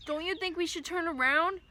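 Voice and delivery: shouting. Acted. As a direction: shout the question into the rain. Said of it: "I shouted".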